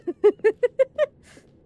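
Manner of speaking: high bursting laugh; loud laugh. Real, not acted.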